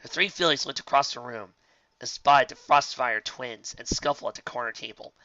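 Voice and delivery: ordinary delivery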